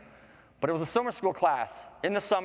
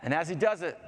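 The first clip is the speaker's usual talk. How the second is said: plain reading voice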